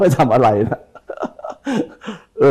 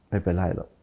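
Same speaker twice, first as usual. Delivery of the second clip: "ไม่เป็นไรหรอก" dropped much softer, curt and brushing it off; dismissive tone; 5 semitones lower, 10 dB quieter than usual. Shown as a demonstration. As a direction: brush it off coldly. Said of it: "เสียงมันต่างกัน". (พูดปัดๆ ไม่ใส่ใจ)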